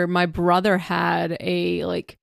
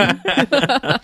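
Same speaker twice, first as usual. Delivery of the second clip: pirate voice; 7 semitones higher than usual. Pretend. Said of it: "It's fun to talk like a pirate".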